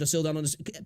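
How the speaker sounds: ordinary talk, nothing marked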